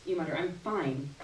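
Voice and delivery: usual speaking voice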